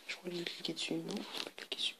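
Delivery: quick whispered correction to himself; fast whisper. Real, not acted. Correcting himself about the ad in a fast whisper. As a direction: whisper it fast under your breath.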